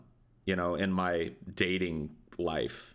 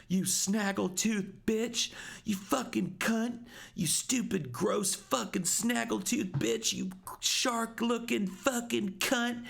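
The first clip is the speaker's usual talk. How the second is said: high-pitched, drawn-out mock tirade in a put-on girl voice; hammed-up impression of a furious teen girl screaming at her rival; mock shrill screaming girlfriend. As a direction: play the jealous ex hammering every insult, pitched way up and stretched. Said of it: "Tammy's just unleashing".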